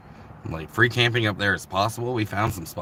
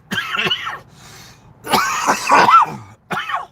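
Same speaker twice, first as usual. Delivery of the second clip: long hacking cough; harsh coughing fit; 10 dB louder than usual. Real, not acted. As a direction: cough it out hard.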